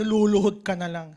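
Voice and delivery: ordinary talk, nothing marked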